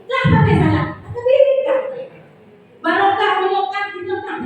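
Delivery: finger-wagging scolding. Acted. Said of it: "Dan jarinya menunjuk-nunjuk".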